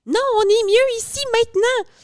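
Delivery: high, loud bratty inner voice; whiny inner voice. Retold or imitated. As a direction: whine it like a spoiled brat. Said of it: "ta bitch voice".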